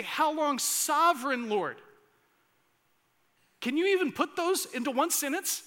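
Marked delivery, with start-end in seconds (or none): 0.0-1.8 higher, loud, slow and pleading; loud cry. Acted. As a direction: cry it out, drawn out. Voicing saints' cry.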